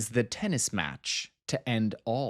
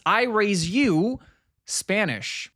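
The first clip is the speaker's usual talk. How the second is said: mock challenge; 8 semitones higher, 5 dB louder, slower than usual.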